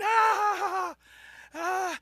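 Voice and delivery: long, high, loud "aah"; mock overwhelmed yell. Man caught between fire hoses, high mock yell. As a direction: cry out as if hit by gushing water. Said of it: "he's in the middle of this thing going".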